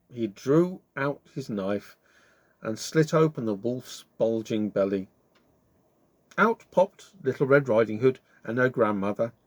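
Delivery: ordinary delivery, nothing marked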